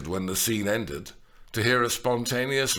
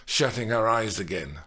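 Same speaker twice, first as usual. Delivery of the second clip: ordinary delivery, nothing marked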